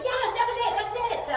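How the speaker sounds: high, loud celebrating; excited praise. Acted. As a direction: re-enact jubilant praise for a dog. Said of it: "And I said".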